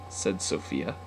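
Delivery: ordinary delivery, nothing marked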